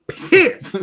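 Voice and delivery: sudden high, loud pissed. Real, not acted. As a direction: spit out the word pissed. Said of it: "I was".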